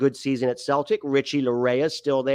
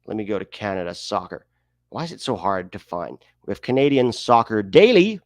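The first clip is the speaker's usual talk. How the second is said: usual speaking voice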